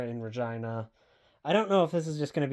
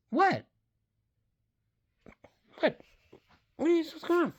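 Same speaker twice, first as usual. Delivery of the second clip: cat-talk voice. Acted. Talking to her cats.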